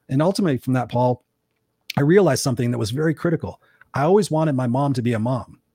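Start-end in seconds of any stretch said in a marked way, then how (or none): none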